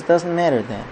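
soft, subdued brush-off; quietly upset. Acted. Weeping daughter brushes off her father's question, quietly upset. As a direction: play a weeping daughter holding it in. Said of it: "she just starts weeping".

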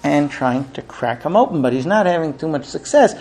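usual speaking voice

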